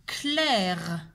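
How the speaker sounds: "éclair" split after é, then a long clair; every sound drawn out. Shown as a demonstration. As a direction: dictate "éclair" slowly so each sound is heard. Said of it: "Écoutez bien tous les sons". (« éclair » articulé lentement, son par son)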